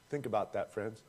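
ordinary talk, nothing marked

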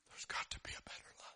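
soft unvoiced wish; hushed yearning whisper; whispered longing. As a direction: breathe out the longing. Eagle's inner longing.

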